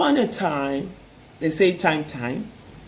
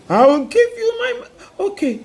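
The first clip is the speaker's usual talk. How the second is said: little man voice; 7 semitones higher than usual. A narrator's reading. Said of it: "said the little man".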